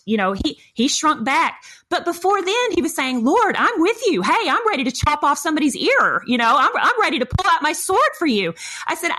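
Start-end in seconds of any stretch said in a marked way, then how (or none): 3.2-8.5 higher, bouncy mock bravado; eager pledge. Peter promising the Lord he will fight for him, eagerly. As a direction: pledge it eagerly, pitch swinging high.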